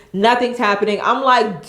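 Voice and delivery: ordinary talk, nothing marked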